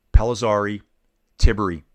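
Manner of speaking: usual speaking voice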